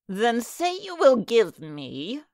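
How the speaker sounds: slow, crafty little man; sly hobgoblin. A narrator's reading. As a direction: bargain slowly and slyly. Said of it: "said the little man".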